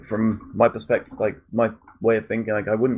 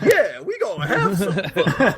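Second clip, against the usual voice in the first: high, slow put-on character voice, laughing; hammed-up game character; 10 semitones higher, slower than usual. Voicing B D Joe's greeting from the game, high and slow. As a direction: drag it out high like a goofy cab passenger.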